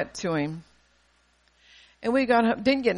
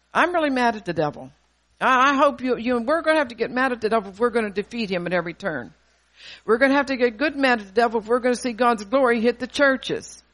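rising, louder exhortation with building force; fiery preaching; 2 semitones higher, 4 dB louder than usual. Acted. Preacher urging ministers to get mad at the devil, fiery. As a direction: rouse the congregation against the devil.

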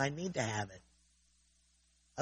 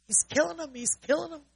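high, frantic retold scream; 11 semitones higher than usual. Retelling the kids' panic, high frantic scream. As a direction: mimic the panicked neighbor kids' cry. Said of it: "we heard the screaming next door".

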